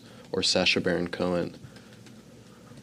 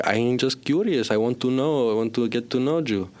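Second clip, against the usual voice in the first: mock Belgian-Cuban accent; 4 semitones higher than usual. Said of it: "in our new roles".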